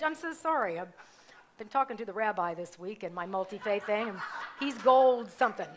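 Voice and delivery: ordinary talk, nothing marked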